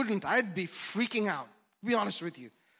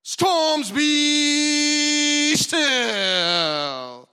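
much higher and louder, yelled command; loud shouting rebuke; 9 semitones higher, 14 dB louder, much slower than usual. Shown as a demonstration. Preacher showing the loud rebuke Jesus did not use, shouted. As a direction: bellow it at the storm. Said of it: "was not a loud".

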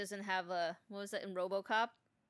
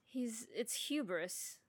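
slow dramatic; 3 semitones higher, 4 dB quieter, much slower than usual. Pretend. Slow mock-grave verdict.

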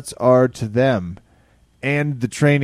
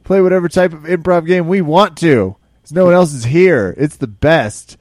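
loud, high and excited; excited shouting; 8 semitones higher, 8 dB louder than usual. Real, not acted. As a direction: hype it up, louder. Excited hype.

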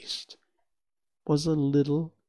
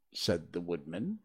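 ordinary delivery, nothing marked

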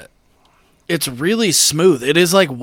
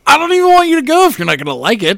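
fast exasperated; 9 semitones higher, 6 dB louder, much faster than usual. Retold or imitated. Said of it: "And I was like".

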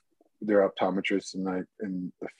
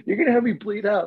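high, loud plea with a laugh; patient begging nurses to focus, high and laughing; laughing exasperation; 12 semitones higher, 5 dB louder than usual. Retold or imitated. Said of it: "I'm like".

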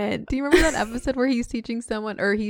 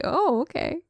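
mild surprise; 7 semitones higher than usual. Retold or imitated. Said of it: "I'm like".

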